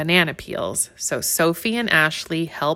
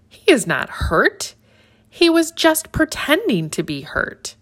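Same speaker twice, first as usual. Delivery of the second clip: plain reading voice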